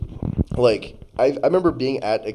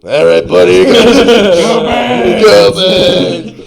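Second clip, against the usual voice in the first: loud, high fan holler breaking into laughter; rowdy stadium fan; 8 semitones higher, 14 dB louder, much slower than usual. Acted. Acted loud stadium exchange.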